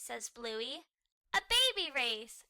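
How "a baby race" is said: put-on loud high child shout. A narrator's reading. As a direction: blurt it out loud. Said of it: "shouts Bingo".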